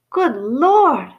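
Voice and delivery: put-on a startled old barman gasps out; surprised old bartender. A narrator's reading. The old bartender, startled at seeing Harry, exclaims. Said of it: "said the bartender".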